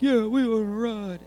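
put-on high, eager kid voice; excited kids. Kids answer the golf-cart driver, high and eager. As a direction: squeal it like a kid who wants the ride. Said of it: "always the kids are like".

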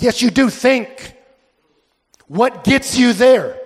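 high, emphatic retold challenge to a friend; acted-out insistent coaxing. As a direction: press your buddy for an answer. Acts out buddy pressing his friend.

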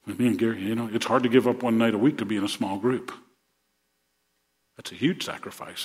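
normal talking voice

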